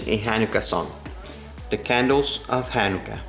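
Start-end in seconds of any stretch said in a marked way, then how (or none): none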